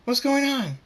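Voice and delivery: high, loud, alarmed question; panicked scream. A narrator's reading. Pink Pie hears an explosion go off, panicked scream. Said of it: "Pink Pie screams".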